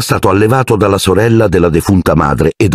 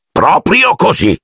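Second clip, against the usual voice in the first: sour; 13 semitones higher, slower than usual. A narrator's reading. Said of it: "disse zio Vernon acido".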